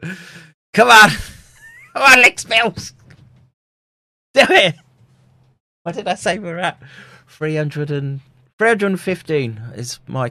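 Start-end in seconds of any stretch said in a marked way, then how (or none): 0.0-4.7 loud, high, eager urging; excited cheering. Real, not acted. Cheering on donations.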